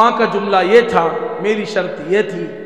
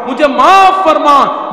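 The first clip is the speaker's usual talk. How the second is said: slow plea; 6 semitones higher, 8 dB louder, slower than usual. Acted. Repeated plea, slowed.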